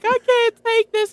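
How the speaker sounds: high, emotional protest; overwhelmed refusal of the gift. Retold or imitated.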